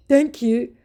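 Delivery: high, odd put-on voice; weird thank you. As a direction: say it oddly, a bit off. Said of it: "he weirdly said".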